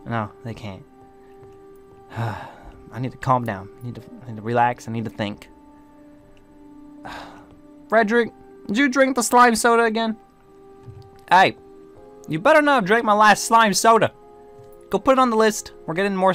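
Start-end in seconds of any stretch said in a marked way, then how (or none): 2.1-5.5 softer, lower self-soothing after a sigh; calming oneself. Acted. Sighs, trying to calm self. 7.1-14.1 higher-pitched indignant scolding. Acted. Scolding Frederick over missing soda.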